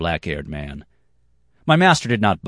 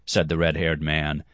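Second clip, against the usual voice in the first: ordinary delivery, nothing marked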